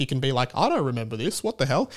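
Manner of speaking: normal talking voice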